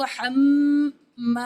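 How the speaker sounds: ہم held long and nasal, then ما with a long aa; teacher demonstrating ghunna and madd. Shown as a demonstration. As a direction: hum the ہم, then stretch ما. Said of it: "دونوں طرف سے اس طرح پڑھنا ہے".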